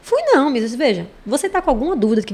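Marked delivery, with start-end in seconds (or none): 0.0-0.8 higher, louder pupil insisting he never went; insistent denial. Retold or imitated. Pupil insisting to the teacher he never went, higher louder denial. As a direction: push back hard, indignant kid. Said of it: "Eu vi, você foi pra Lua".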